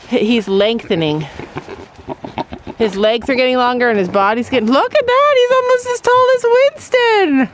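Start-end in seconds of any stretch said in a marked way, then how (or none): 4.7-7.5 cooing. Real, not acted. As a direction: coo at him in a high voice. Cooing at the pig.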